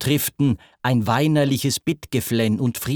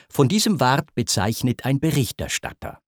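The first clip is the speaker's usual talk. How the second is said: ordinary delivery, nothing marked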